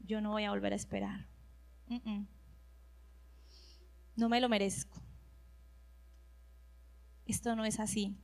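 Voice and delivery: very soft, low sulking complaint; sulky grumble. Retold or imitated. Preacher retelling her impatient inner voice, soft sulky grumble. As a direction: grumble it to yourself, hurt and stubborn. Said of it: "esta débil humanidad empezó a decir".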